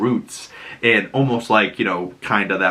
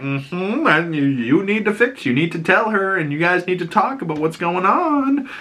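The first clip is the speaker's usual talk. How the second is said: higher, a lightly voiced knowing older woman; tipsy Gail; 8 semitones higher than usual. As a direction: hand out advice between drinks. Gail giving advice while drinking.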